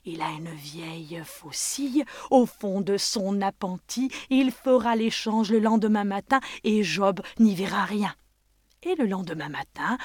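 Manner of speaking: ordinary delivery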